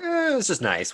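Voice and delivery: high-pitched, noncommittal "eh"; dismissive shrug. Retold or imitated. Retells own offhand reaction.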